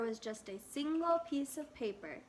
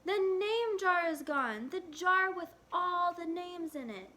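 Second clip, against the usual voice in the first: put-on loud, higher child's call; shouting; 6 semitones higher, 4 dB louder than usual. A narrator's reading. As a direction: blurt it out loud to the teacher. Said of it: "Ralph shouted at him".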